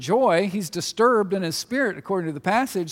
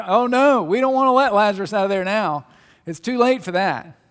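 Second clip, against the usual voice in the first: alarmed Martha; 3 semitones higher, 8 dB louder than usual. Retold or imitated. Preacher voicing Martha, loud and alarmed. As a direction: cry out in alarm to stop him.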